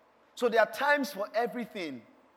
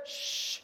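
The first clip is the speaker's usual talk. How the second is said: unvoiced, softer hushing sound; sharp shush; 8 dB quieter than usual. Crowd silencing blind Bartimaeus, shushing. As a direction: hush a noisy man.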